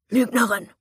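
loud snarl of liar; angry accusation. Acted. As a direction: spit it out in anger. Attacker snaps at her.

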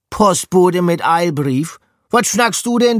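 put-on high, loud Hamburg-accented pub mouse; nasal Hamburg accent. A narrator's reading. Pub mouse waking up, questioning the rat in Hamburg dialect. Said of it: "näselte es auf Hamburgerisch".

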